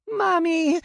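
put-on very high, loud little-girl scream; little girl screaming as mother dies; child's scream. Child sees mother killed.

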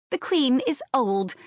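high, firm retort; staunch. A narrator's reading. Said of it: "Anne replied staunchly".

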